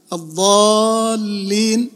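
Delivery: الضالين drawn out very slowly, heavy D and long aa; doubled dad. Shown as a demonstration. Teacher demonstrating shadda, stretched recitation of الضالين. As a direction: hold the aa in الضالين, then the double L. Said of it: "فيها شدة".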